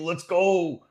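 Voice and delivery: high, loud excited whoop; rallying cheer. Real, not acted. Commentator after shouting out viewers, loud rallying cry. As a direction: cheer them on at full voice.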